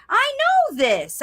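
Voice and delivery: put-on second go pitched high, eager toddler; the kid getting excited about knowing it; toddler thought, rising. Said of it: "Because they're like".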